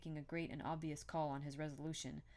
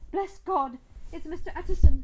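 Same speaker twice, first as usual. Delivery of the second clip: high, very loud cry; 12 semitones higher, 20 dB louder than usual. A narrator's reading. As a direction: cry out at the top of your voice as the cook. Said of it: "the cook, crying out".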